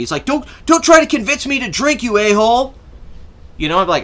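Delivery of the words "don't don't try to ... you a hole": high-pitched mock tirade; hammed-up, worked-up protest; imitating crazy outburst. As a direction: mimic a friend flipping out, voice pitched way up. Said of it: "he would just go crazy on me".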